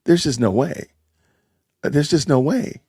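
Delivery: ordinary talk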